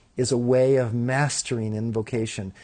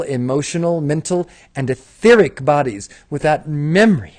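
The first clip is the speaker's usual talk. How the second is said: louder, commanding prayer decree; forceful decree; 4 dB louder than usual. Acted. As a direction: command the energies with full force.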